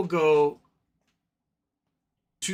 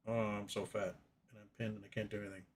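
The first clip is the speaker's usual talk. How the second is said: voicing the cat; 5 semitones lower, 13 dB quieter than usual.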